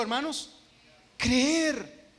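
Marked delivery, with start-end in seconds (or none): 1.2-2.1 emphatic answer. Real, not acted. Preacher answers his own question.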